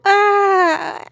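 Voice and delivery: high mock cry of frustration; mild imitation of a crying child's scream; imitated scream. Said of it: "I would scream".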